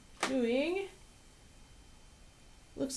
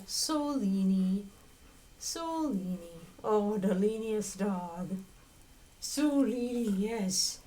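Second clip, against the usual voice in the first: squeaky praising a dog; slower than usual. Real, not acted. Cooing at the leaning dog.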